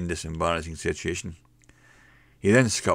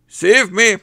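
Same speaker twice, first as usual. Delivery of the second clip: high, loud terrified yelp; frightened shout; 13 dB louder than usual. A narrator's reading. Cowardly barman crying for help, high terrified shout. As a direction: scream for help in a panic. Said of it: "shouts the Fearty".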